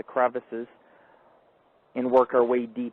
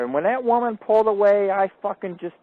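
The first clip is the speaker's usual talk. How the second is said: normal talking voice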